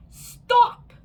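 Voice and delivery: very high, very loud shout; shouted stop. A narrator's reading. Teacher shouts at the laughing girl, very loud. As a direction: cut the class off sharply. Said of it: "said Missus Gomez".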